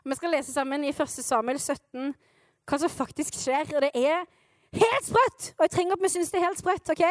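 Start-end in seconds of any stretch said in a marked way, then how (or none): 4.8-5.5 high, sudden burst of amazement; hyped-up outburst; amazed exclamation. Real, not acted. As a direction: exclaim it, pitched well up. Preacher exclaims how crazy it is.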